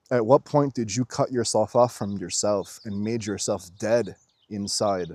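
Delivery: usual speaking voice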